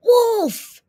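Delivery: very loud, high shout of alarm; crying out. A narrator's reading. Boy spots the wolf zooming in, alarmed shout. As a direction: holler a warning across the rink. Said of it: "A boy cried".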